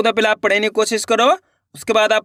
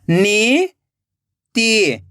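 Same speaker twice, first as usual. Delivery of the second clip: नीति split into नी, long pause, ति; rising नी, then falling ति; syllable by syllable; much slower than usual. Shown as a demonstration. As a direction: sound out नीति very slowly in two parts. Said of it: "एक एक अक्षर का उच्चारण करना है".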